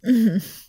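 sudden bright laugh; teasing laugh; loud laugh. Real, not acted. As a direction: burst out laughing.

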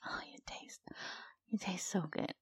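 normal talking voice

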